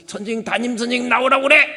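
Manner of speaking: an enraged dad demanding the teacher; 화난 아버지의 고함; furious father's shout. Retold or imitated. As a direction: bellow it high and loud. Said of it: "막 이래버려서 실제로".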